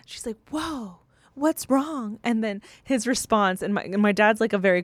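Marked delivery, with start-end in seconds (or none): 0.5-2.2 high, drawn-out mock surprise; stretched, higher-pitched retold puzzlement; imitated taken-aback mom. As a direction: play a mother caught off guard, slowly. Retells mom noticing upset dad.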